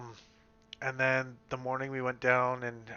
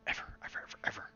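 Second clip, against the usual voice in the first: whispered; 6 dB quieter, much faster than usual. Real, not acted. Trailing repeated ever.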